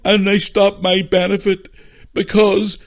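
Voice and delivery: slow, high, blubbering whine; hammed-up sobbing self-pity, words dragged out; mock crying. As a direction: imitate a young man bawling. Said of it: "like, crying".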